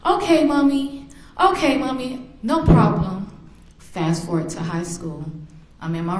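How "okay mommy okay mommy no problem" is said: put-on higher, sweet little-boy agreeing; little son's voice. Mother voices her adoring young son, imitated little-boy voice. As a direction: imitate a small child answering Mommy. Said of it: "with his little eyes".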